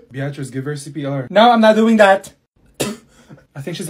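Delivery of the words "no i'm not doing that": very loud, blurted no; emphatic refusal. Acted. Classmate told to give CPR, loud refusal. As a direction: refuse at full volume.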